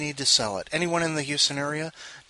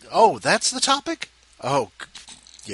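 usual speaking voice

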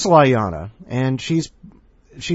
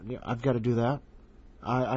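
usual speaking voice

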